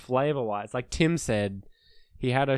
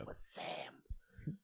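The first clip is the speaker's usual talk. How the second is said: whispered aside; 22 dB quieter, much slower than usual. Real, not acted. Revealing the stranger was Sam, slow whisper.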